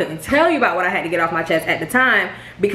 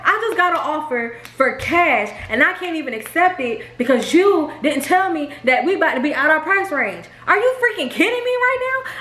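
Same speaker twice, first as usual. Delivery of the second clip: retold outraged rant; 7 semitones higher than usual. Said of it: "And I'm like".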